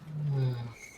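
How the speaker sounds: a long, weary in-character sigh; exasperated sigh. Acted. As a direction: let it all out in one tired breath. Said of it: "exasperated sigh".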